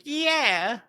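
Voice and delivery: high, goofy put-on voice; mock empty praise. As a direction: parody a nothing-burger compliment. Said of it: "Fucking nothing burger statement is like".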